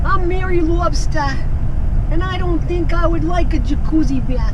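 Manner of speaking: high, squeaky female lobster voice; Mary Lobster voice. Pretend. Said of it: "What's Mary Lobster's voice?".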